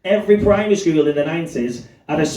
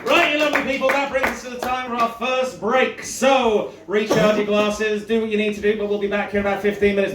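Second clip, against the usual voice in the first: comedy club compere; 8 semitones higher than usual. Real, not acted. Compere announcing the break.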